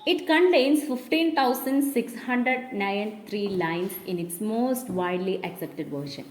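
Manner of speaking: reading the English text very slowly for notes; measured classroom dictation. Shown as a demonstration. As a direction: read it out slowly so the class can write it down.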